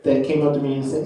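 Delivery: ordinary delivery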